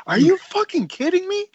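high-pitched, incredulous yell; outraged coach. Retold or imitated. Coach hears player is high, outraged high yell. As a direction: blow up in disbelief, pitch shooting way up. Acts out coach's angry reaction.

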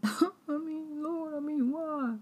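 high laughing cry to the Lord; laughing plea. Real, not acted.

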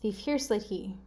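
Thief hears late he run together. Shown as a demonstration. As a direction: blend the words into a phrase. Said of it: "better if I read it fast".

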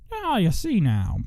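slow, high swooping put-on voice; a badly faked voice, drawn out; bad character voice. Host trying out silly voices, bad character voice. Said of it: "That's a bad one".

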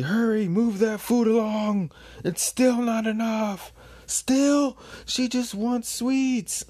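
very high, loud frantic shouting; hammed-up harried yelling, stretched at the end; frantic worker. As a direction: urge them on in a panicked high cry. Harried worker urging others on.

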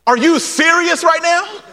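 high, rising mock disbelief; incredulous. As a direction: ask it as if you can't believe it. Mock disbelief at the blind men.

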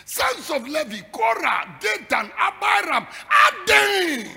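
rising shouted roll call of names. Acted. Preacher naming Korah's rebels, building to a shout.

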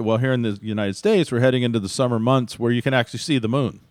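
ordinary delivery

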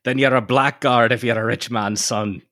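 high-pitched stage-Irish lilt; caricature Irish accent. Acted. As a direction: play up an old-fashioned Irish-American brogue. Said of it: "nineteenth century caricatures of Irish Americans".

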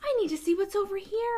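put-on high, airy teen voice; mock oblivious character. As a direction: play the clueless horror victim. Voicing a careless character.

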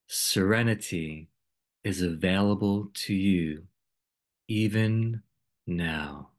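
calm, unhurried meditation voice with long pauses; guided meditation. Acted. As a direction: guide listeners gently into stillness, phrase by phrase.

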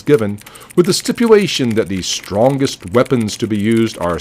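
loud, formal news bulletin read; old radio announcer. Pretend.

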